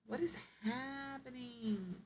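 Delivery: soft, high, drawn-out bewildered complaint; exasperated. Real, not acted. Player to the game as painting will not work, exasperated. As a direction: stretch it out slowly, high and quiet, in annoyed confusion. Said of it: "You wanna talk about being annoyed?".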